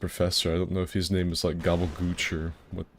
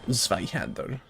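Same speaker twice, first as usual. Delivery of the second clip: put-on Zweihander in a German accent; mock-proper German Zweihander; put-on German pronunciation; 3 semitones higher, slower than usual. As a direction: switch into proper German for the word. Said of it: "all proper and shit".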